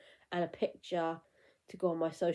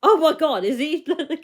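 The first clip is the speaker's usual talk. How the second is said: higher, louder, laughing excited question; starstruck squeal; 8 semitones higher, 10 dB louder, faster than usual. Retold or imitated. Spotting a lookalike she takes for her idol, a loud giggly squeal. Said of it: "And I was like".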